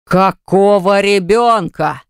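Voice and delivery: slow, drawn-out startled cry; exclaiming. A narrator's reading. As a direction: cry it out, caught off guard. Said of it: "вскрикнул Герман". (вскрикнул)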